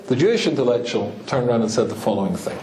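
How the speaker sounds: normal talking voice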